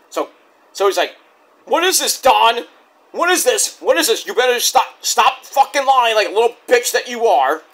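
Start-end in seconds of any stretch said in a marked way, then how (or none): 1.7-7.6 higher, insistent mock anger; imitated accusing tirade; angry confrontation. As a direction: press him with repeated demands. Jericho confronts Don, acted.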